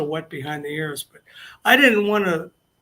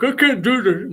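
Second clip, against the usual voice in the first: whiny big guy; 6 semitones higher, 6 dB louder than usual. Retold or imitated. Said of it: "this big hunky guy's like".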